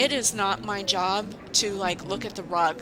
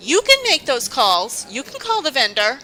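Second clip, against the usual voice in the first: higher, louder condescending voice; mock lecturing boss; 6 semitones higher, 6 dB louder than usual. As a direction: imitate her lecturing tone, pitched up.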